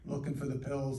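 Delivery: ordinary talk, nothing marked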